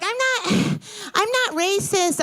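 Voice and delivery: put-on high, slow, sighing valley girl voice; hammed-up whiny denial; mock defensive girl.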